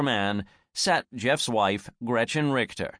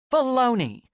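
exclaiming; 12 semitones higher, 4 dB louder than usual. Acted. Anne explodes at Rebecca.

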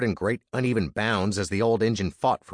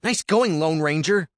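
higher, louder sarcastic snap; snapped; 8 semitones higher, 5 dB louder than usual. Acted. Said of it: "snapped Andy".

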